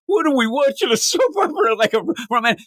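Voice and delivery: high-pitched imitation of a baffled complaint; mock incredulity. Comic book character complaining about watching a soap opera, mock incredulous. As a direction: raise the pitch right up, as if appalled.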